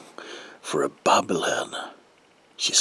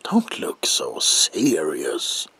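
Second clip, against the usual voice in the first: normal talking voice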